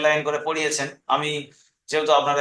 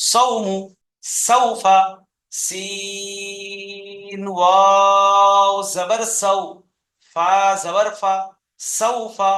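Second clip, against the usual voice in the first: measured chant, "সিন" and "wow" held very long; spelled piece by piece; 2 semitones higher, much slower than usual. Shown as a demonstration. Teacher modelling word spelling for pupils, slow segmented chant. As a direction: stretch the letter names, then join up "সাউফা". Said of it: "কেটে কেটে বানান করবেন".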